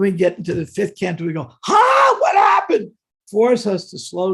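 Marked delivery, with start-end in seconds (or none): 1.6-2.9 much higher and louder, a hammed-up cry; mock shocked confusion. Teacher acting readers stunned by the fifth canto: loud high mock alarm. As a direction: yelp it like you just hit a speed bump.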